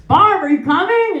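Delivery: grandfather calling. Retold or imitated. Grandfather calling his wife, mild call. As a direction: call out to her from the next room. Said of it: "my grandfather was like".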